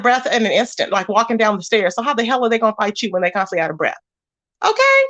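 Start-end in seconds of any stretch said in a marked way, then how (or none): 4.6-5.0 high, sassy okay. Real, not acted. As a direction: toss it off sassily. Sassy retort to chat.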